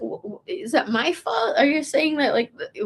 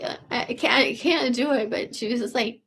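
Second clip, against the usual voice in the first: ordinary delivery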